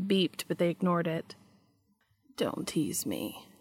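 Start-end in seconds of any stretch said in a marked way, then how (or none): 2.4-3.5 low, slow, much softer plea; hurt whisper. A narrator's reading. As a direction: whisper it, lower and slower, wounded. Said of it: "Cass whispered".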